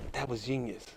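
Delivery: low, barely voiced murmur; hushed admiration. Comic in the crowd admiring another's comeback, hushed admiration. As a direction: murmur it privately, in awe.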